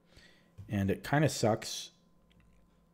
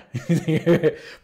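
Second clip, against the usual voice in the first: real burst of loud, high laughter; laughing; 6 semitones higher, 8 dB louder than usual. Real, not acted. Recalling the opponent's claim, a real loud laugh.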